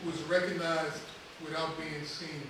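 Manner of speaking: normal talking voice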